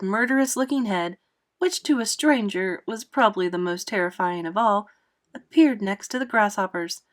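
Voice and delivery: ordinary delivery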